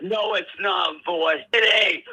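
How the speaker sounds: normal talking voice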